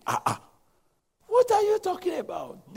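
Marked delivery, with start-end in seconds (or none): 1.3-2.7 high, shouted disbelief; incredulous outcry. Acted. Abraham shouting in disbelief at God's promise. Abraham hearing the baby news.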